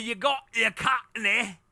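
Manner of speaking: high, slow put-on Cockney; exaggerated, drawn-out Cockney caricature; Cockney accent. Shown as a demonstration. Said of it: "there's different British accents".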